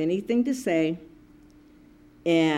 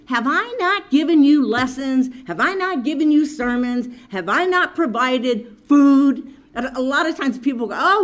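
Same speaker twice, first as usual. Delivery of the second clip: louder, higher preaching with hammered questions; fervent preaching; 6 semitones higher, 3 dB louder than usual. Acted. Preacher voicing the Lord's reassurance to the flock, building preaching. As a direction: preach it with conviction.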